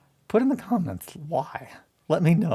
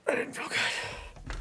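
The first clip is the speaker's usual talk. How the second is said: whisper; 5 dB quieter, slower than usual. Real, not acted. Muttered to self after hitting.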